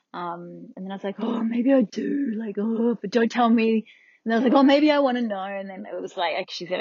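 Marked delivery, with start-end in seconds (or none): 1.2-2.6 higher, slow, drawn-out indecision; torn wavering. Retold or imitated. Patient unsure about hearing her result, torn wavering. 2.6-5.4 torn wavering. Retold or imitated. Patient refusing to hear her result, torn wavering.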